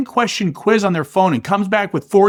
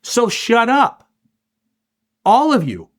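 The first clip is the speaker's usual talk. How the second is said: stern tough-guy order; 5 semitones higher than usual. Retold or imitated. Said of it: "Dana looks at the mess and says".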